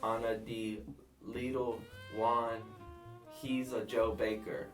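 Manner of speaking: put-on halting broken English in a thick accent; thick foreign accent. Killer explaining the bodies to Dan, thick foreign accent. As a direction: grope for words in a heavy foreign accent. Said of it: "whoever the hell has this thick accent".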